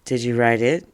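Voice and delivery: ordinary talk, nothing marked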